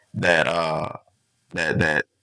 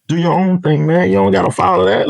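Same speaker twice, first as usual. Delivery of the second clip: put-on much higher, urging the kids on; urging advice; 11 semitones higher than usual. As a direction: lift your voice high, pressing the kids to go their own way. Tell 'em.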